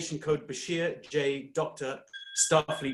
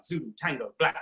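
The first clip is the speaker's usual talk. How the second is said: ordinary talk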